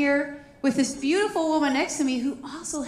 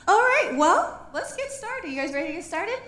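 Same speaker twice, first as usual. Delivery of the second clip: upbeat host; 8 semitones higher, 4 dB louder than usual. Real, not acted. Host kicks off panel.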